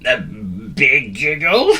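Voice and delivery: slow, half-laughing question; giggly guess. A narrator's reading. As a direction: try the answer with a giggle. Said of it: "Gary suggested".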